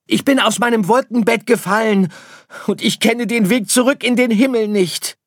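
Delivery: very high, sustained shouting; calling back. Acted. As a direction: shout it up to parents far away. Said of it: "rief Sirius zurück". (hoch und laut nach oben rufen)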